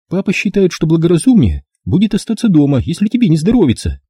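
high, fretful mother's voice; a doting mum sure he is ill; mother worrying about the fog and his weak chest, fretful; fussing mother. A narrator's reading.

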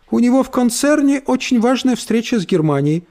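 cartoon princess. Брит Мари хвастается.

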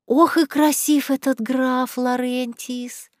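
slow, lingering swoon over the count; drawn out, lost in a daydream; dreamy sigh. A narrator's reading. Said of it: "Люси мечтательно закатила глаза".

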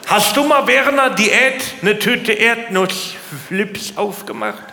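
normal talking voice

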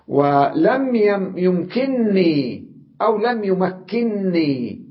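يمكنني said slowly, the نن doubled; slow down and double the ن in يمكنني; slow emphatic reading. Shown as a demonstration. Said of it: "ألفظها نونين". (يمكنني ببطء مع نونين)

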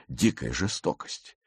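ordinary delivery

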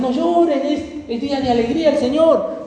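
high, bright-pitched call of joy; mock happy elder urging no tears; joyful believer. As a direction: cheer up the weeping elders. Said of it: "algunos decían".

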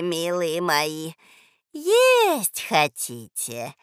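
slow sing-song, swelling into an eager list; luring old woman. A narrator's reading. Old woman tempting hungry children with food, crooning. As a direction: croon a syrupy invitation.

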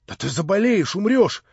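loud, rising exclamation; worried outburst; alarmed warning. A narrator's reading. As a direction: warn urgently, climbing in pitch.

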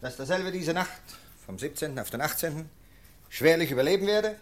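formal official dictation for the record; dictating a report. Acted. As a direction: read it out like a protocol being taken down.

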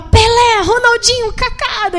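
high, loud eager listing of stars; fan excitement. Retold or imitated. Thrilled guard naming football stars to the visitors. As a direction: rattle off heroes like a thrilled fan.

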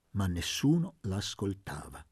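ordinary delivery, nothing marked

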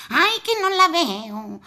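put-on high childish whine; little boy voice. Boy straining to see.

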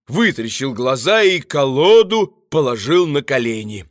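plain reading voice

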